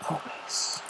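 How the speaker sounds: breathy soft whisper. Acted. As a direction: whisper it like a final kiss. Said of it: "ending the song with a whispered".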